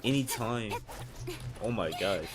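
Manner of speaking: ordinary delivery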